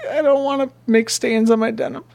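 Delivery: high, fast, whimpering; blubbering on purpose; mock crying. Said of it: "Your denim's gonna get wet".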